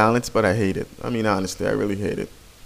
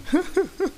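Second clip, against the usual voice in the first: high giggle; 3 dB quieter than usual. Real, not acted. Laugh after accepting request.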